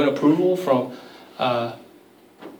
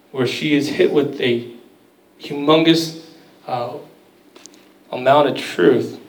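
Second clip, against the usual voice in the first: ordinary talk, nothing marked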